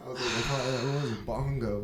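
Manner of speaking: low, slow, let-down delivery; mock disappointment. Guest retelling their letdown over the bongo, mock disappointment. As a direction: drag it out, softly crestfallen. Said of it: "saw my disappointment".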